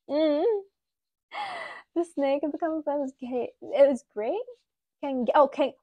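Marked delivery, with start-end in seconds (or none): none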